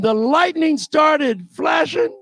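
normal talking voice

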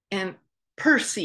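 normal talking voice

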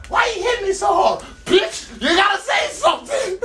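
hammed-up shouting in a squeaky high voice; mock shouted quarrel. Retelling a noisy hookup, mock shouting the lines.

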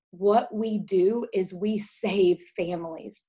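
slow, every word weighted; slow solemn declaration; slow emphatic. Real, not acted. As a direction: lay it down slowly like a creed. Emotional climax of story.

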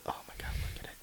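awed hushed whisper. Real, not acted. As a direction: whisper it in awe.

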